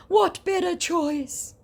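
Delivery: high, loud, slow whiny scammer voice. Acted. Scammer complaining she is rude, high slow whine.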